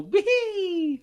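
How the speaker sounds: high Mario-style wee; hammed-up Mario wee; squeaky Mario wee. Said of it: "He can just talk like".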